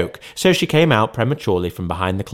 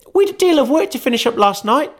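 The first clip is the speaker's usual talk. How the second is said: put-on very high, fast girl's voice; a busy daughter explaining herself quickly; young girl; 4 dB louder, faster than usual. Martha voiced in story.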